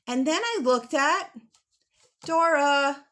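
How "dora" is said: usual speaking voice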